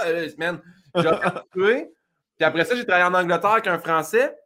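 ordinary delivery

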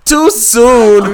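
loud, high mock-scandalized cry; too soon outcry. Real, not acted. Host shouting at his guest's joke, mock outrage.

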